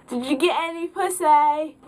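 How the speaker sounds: high, taunting question, leaning on "pussy"; heckling tipsy friend. Retold or imitated. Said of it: "so much emphasis on the word pussy".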